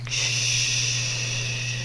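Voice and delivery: long shush into a whisper; creepy joke whisper. Acted. Friend group's freaky joke, whispered after a long shush. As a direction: hush them, then whisper it very softly.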